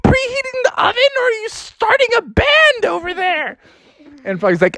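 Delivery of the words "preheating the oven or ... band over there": very high screechy mom voice; hammed-up scolding mom; exasperated mother. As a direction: scold in a high, shouted falsetto.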